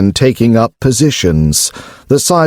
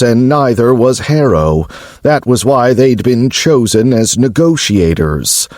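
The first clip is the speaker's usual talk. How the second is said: ordinary delivery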